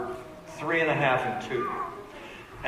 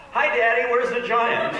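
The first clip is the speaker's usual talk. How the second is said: put-on eager child; 8 semitones higher, 5 dB louder than usual. Kids arriving off the plane.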